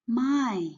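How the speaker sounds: "my" as Mandarin mai, falling from high; fourth tone. Shown as a demonstration. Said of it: "this is the fourth tone".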